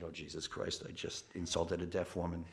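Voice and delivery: soft horrified mutter; horrified aside. Retold or imitated. Narrator realizing he insulted a deaf woman, quiet dismay.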